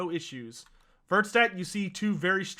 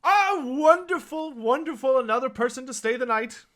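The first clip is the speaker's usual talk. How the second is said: delighted barkeep greeting; 7 semitones higher, 5 dB louder than usual. Acted. Barkeep cheerfully greeting new guests at the inn. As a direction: fling out the welcome like a thrilled host. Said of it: "Verity goes".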